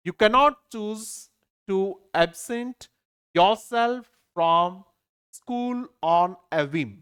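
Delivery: very slow, phrase by phrase with pauses; slow dictation reading. Shown as a demonstration. Teacher reading the second question to the class, slowly and deliberately. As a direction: read it out deliberately, stretching the words. Teacher reads the exam sentence.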